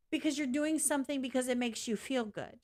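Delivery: ordinary delivery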